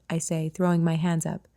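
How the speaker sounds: usual speaking voice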